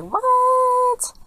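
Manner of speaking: very high shocked yelp; shocked what. Retold or imitated. Kids learning grandparents are spies, high shocked yelp. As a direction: squeak out total disbelief.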